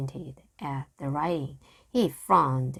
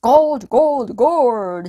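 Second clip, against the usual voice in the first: high loud gleeful gold cry; greedy cry; 6 semitones higher, 11 dB louder, much slower than usual. A narrator's reading. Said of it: "he cried".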